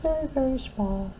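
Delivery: very high, soft tiny voice. Acted. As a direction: make it sound very small. Tiny lighthouse.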